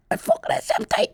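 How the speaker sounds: excited hushed whisper, high and soft. Retold or imitated. Cop telling his partner who he pulled over, excited whisper. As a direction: whisper it like thrilling news.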